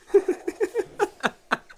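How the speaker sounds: high helpless laugh; burst of laughter. Real, not acted. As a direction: break into laughter.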